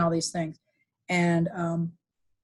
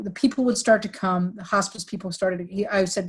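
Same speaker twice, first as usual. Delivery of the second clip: ordinary talk, nothing marked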